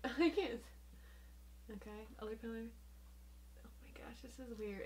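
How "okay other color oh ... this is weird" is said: unvoiced whispered remark; whispering. Real, not acted. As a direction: whisper it, bewildered. Hushed remark on the goggles.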